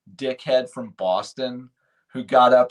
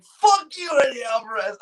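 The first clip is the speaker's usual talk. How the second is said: fanboy outburst; 11 semitones higher than usual. Retold or imitated. Fan jeering at the fighter in the moment, mock yell. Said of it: "And I'm just like".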